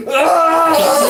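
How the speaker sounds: high loud cry of "Ah"; loud yelp. Pretend. Player reacts to a knocked funny bone, high laughing yelp.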